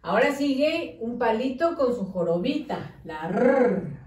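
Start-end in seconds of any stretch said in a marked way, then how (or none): 3.0-4.0 R said as a long rolled trill; teacher's drawn-out trilled R sound; letter sound R. Shown as a demonstration. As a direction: roll the R out as "rrr". Sounds out letter being written.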